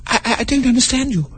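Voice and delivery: put-on high, puzzled girl's voice; Alice, lost and confused; lightly voiced puzzled girl; young Alice. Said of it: "said Alice".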